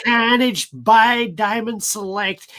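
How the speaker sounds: high, loud, slow nasal American; hammed-up bad American presenter voice; bad geeky American accent. Shown as a demonstration. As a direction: announce it as a geeky American reviewer. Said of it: "like, bad American accent".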